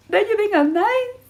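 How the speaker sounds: mock eager plea. Girlfriend seeking approval.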